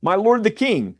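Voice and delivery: David calls out to King Saul; calling out. Acted. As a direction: call after the king from the cave. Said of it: "called after Saul".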